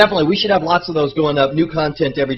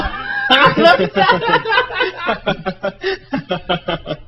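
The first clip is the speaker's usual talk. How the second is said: high burst of laughter; 13 semitones higher than usual. Real, not acted. Big laugh.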